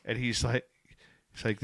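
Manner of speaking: ordinary delivery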